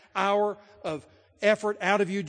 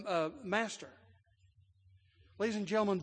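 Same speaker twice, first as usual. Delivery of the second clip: ordinary talk, nothing marked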